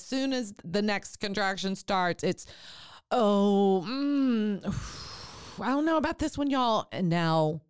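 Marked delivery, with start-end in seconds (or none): 3.1-6.9 higher, strained groan and sigh, then a worried line; uneasy laboring mom. Retold or imitated. A mom in labor hit by the next contraction, strained and uneasy. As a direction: play a mom caught by a hard contraction, uneasy. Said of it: "she is not talking in a pleasant voice".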